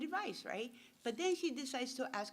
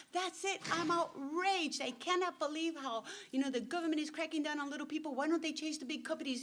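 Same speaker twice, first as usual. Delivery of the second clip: outraged lawyer; 7 semitones higher than usual. Retold or imitated. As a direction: play the lawyer fuming at the government. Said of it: "He's, like, freaking out".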